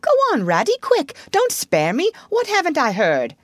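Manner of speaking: high, urgent begging; alarmed pleading. A narrator's reading. Toad begging Rat not to spare him, alarmed. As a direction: press for the news in high-pitched dread. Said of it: "turning rather pale".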